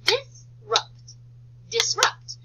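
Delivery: teacher clapping syllables, loud. Shown as a demonstration. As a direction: clap out "dis" and "rupt". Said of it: "And I'd like to clap".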